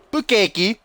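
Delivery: Bukaki said as "pu-keh-ki" in a high, loud, put-on London accent; mock London accent. Mocking how a London fan might say Bukaki, in a London accent. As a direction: ham up a Londoner saying Bukaki. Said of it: "in London or something".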